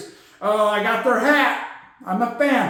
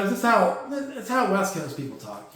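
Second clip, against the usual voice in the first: normal talking voice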